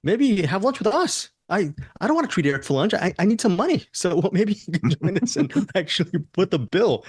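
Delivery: bright, high announcer patter; podcast shill voice. Acted. Host plugging lunch to listeners, high podcast shill voice. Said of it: "[name]'s real voice, not my podcast shill voice".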